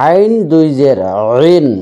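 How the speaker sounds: আইন and রিন squeezed from mid-throat; throat-pressed Ain. Shown as a demonstration. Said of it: "চাপ দিয়ে উচ্চারণ".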